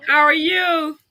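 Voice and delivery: high, sweet voice for a little one. Real, not acted. Greeting his baby.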